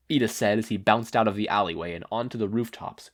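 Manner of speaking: ordinary delivery, nothing marked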